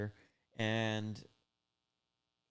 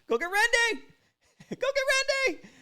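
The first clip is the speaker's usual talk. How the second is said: much higher, louder teasing yell, laughing in between; mocking yell; 9 dB louder than usual. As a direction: mock-scream the threat back at him. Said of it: "And he's like".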